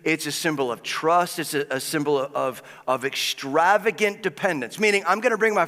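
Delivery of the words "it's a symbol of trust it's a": usual speaking voice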